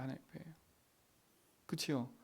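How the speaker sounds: ordinary talk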